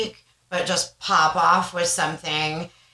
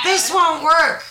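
high, loud, slow mock complaint; retold frustrated protest; 11 semitones higher, 7 dB louder than usual. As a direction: drag out the protest loudly, in frustration. Acts out stubborn frustrated protest.

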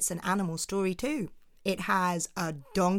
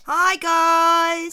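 high, loud, squealed hello; excited greeting; 10 semitones higher, 11 dB louder than usual. Real, not acted. Shout-out greeting to young fans.

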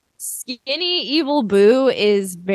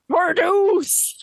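held-back high mock yell; imitated scream; 8 semitones higher than usual. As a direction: imitate a villain's scream, pitched up. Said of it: "he just screams".

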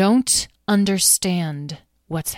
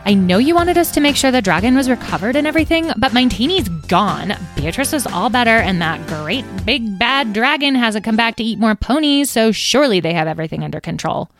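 moody rant; 5 semitones higher, 6 dB louder than usual. Acted. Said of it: "they asked moodily".

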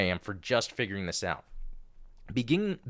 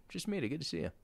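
breathless after a laughing fit; after laughing hard; 3 semitones higher, 10 dB quieter, much faster than usual. Real, not acted. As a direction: still catching breath from laughing. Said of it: "I need to compose myself".

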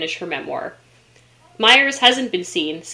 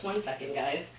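usual speaking voice

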